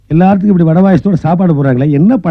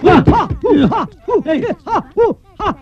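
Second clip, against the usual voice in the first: high, sharp karate cries; mock karate yells; 13 semitones higher, 4 dB quieter than usual. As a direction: bark out martial arts shouts for show.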